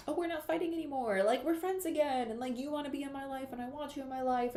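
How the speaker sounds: high, sweet friends-again voice; gushing make-up talk. Pretend. To money after a fight, high gushing tone. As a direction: coo it like making up with a friend. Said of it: "this energy of".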